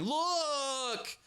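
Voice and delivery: put-on shrill high "Look! Look!" like a tattling kid; mock a whiny accuser pointing and crying "Look! Look!"; mock tattletale. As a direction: whine it out like a child pointing and telling on someone. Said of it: "keep pointing to him going".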